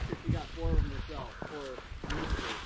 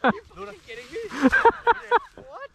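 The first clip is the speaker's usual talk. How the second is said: loud laughing disbelief; surprised; 11 semitones higher, 8 dB louder than usual. Real, not acted. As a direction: burst out in amazed laughter. Dog grabbed the bird.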